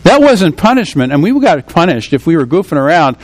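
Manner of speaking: normal talking voice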